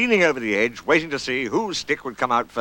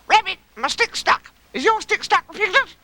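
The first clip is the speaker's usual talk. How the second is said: very high, anxious little voice; high-pitched childlike calling; Roo; 3 dB louder than usual. Acted. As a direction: squeal it like an excitable little one.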